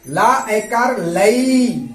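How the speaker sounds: very slow, stretched syllables; very slow sounding out. Shown as a demonstration. Teacher stretching the syllables slowly for pupils. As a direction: draw out each syllable for beginners. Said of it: "तो क्या होगा".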